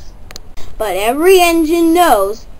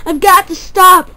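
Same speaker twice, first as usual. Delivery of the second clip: higher, louder panicked puff; louder out of breath; 6 semitones higher, 5 dB louder than usual. Acted. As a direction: push it up in panic, panting. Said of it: "puffed Thomas out of breath".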